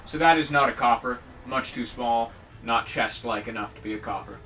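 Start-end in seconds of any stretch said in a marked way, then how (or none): none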